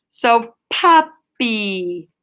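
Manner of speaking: "puppy" said slowly in two parts, pup-py; showing how to split the syllables. Shown as a demonstration. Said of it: "that's my syllable. I'm gonna break it up".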